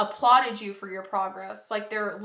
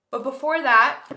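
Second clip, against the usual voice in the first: talking through a yawn; 4 semitones higher, 9 dB louder than usual. Real, not acted. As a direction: yawn mid-sentence. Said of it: "sorry if I keep yawning".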